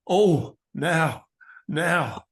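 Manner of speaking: presenter impression with a little quaver; Noel Edmonds. Shown as a demonstration. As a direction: demonstrate a TV host's quavering excitement. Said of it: "I struggled with Noel Edmonds".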